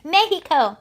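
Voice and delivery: "Mexico" said Spanish-style as MEH-hee-ko; playful Spanish "Mexico", MEH-hee-ko; Spanish pronunciation. Pretend. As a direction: say "Mexico" with an h sound for the x.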